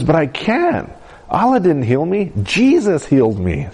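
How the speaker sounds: high-pitched, insistent excitement; excited. Retold or imitated. Healed girl contradicts her dad, excited and high. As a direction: protest with irrepressible joy. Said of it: "She was so excited".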